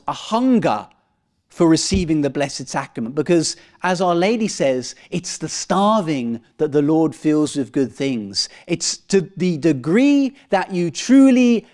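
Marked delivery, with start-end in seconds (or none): none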